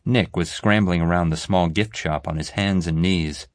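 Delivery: plain reading voice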